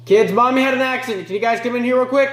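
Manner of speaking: quick, high-pitched call; calling out to the kids. Acted. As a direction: summon children from another room. Calling the children, acted.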